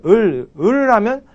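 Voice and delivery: "을" hummed with the tongue pressed up; slow "을, 을" with the tongue on the palate; pronunciation demo. Shown as a demonstration. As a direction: prepare the l with a held "을". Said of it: "앞에 을".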